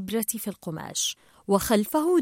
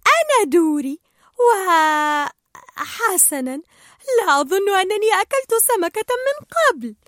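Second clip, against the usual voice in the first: Dory's testimony; 8 semitones higher, 4 dB louder than usual. A narrator's reading. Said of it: "قال الدوري".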